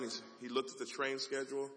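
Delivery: usual speaking voice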